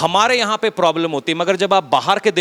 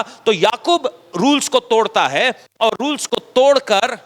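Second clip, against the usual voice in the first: normal talking voice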